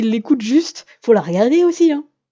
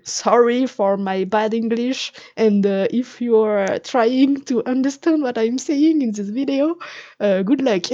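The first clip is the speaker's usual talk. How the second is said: slow, careful English with a French accent; drawn-out, apologetic English in a thick French accent; slower than usual. Real, not acted. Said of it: "vous pouvez voir mon super accent".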